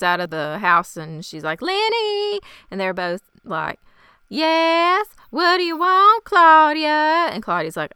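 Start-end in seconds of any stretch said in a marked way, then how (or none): 1.6-2.4 high, drawn-out sing-out of a name; mock high-pitched call. Claudia calls out to twins. 4.3-7.3 high, loud, drawn-out whine; exaggerated high singsong reply; mock irritated answer. Twins answer Claudia in chorus.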